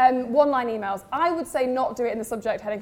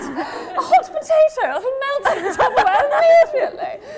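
posh Tesla voice; 9 semitones higher, 7 dB louder than usual. Pretend. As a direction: put on a squeaky posh accent. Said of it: "So that's my posh Tesla voice".

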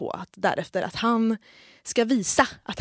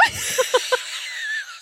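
very high, shrieking burst of laughter. Real, not acted. Friend hearing "no, nothing", shrieking laugh.

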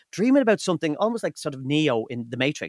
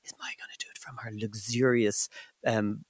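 mock whisper; 5 semitones lower, 9 dB quieter than usual. Teasing co-host about lazy recording.

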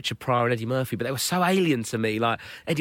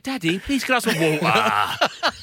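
little daughter asking; 8 semitones higher, slower than usual. Retold or imitated. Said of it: "my daughter's like".

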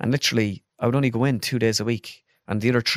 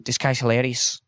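Scouse accent impression; quick burst of imitated Scouse; Jamie Carragher; 2 semitones higher than usual. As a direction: put on a Liverpool accent. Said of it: "I don't know why I'm doing his accent".

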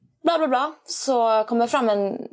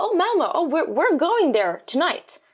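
very high, slow mock delight; excited American guy; 8 semitones higher, slower than usual. Stranger thrilled she's from Malmö, high mock excitement. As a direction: gush about Malmö like a tourist. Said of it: "Han bara".

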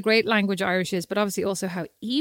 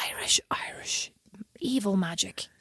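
hushed, spooky whisper; 8 dB quieter, slower than usual. Retold or imitated. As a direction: whisper it like a dark secret. Spooky joke about Irish spells.

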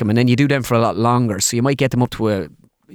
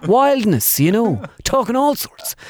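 Crazy Tommy; 11 semitones higher, slower than usual. Shown as a demonstration. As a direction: lift the roof off it, wildly. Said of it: "I come out as Crazy Tommy".

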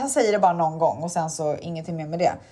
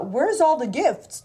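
Santa voice; 4 semitones higher, slower than usual. Retold or imitated. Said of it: "Han bara".

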